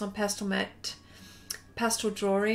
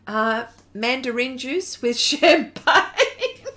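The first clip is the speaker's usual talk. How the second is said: laughing; 8 dB louder, slower than usual. Real, not acted. Joking about mandarin juice.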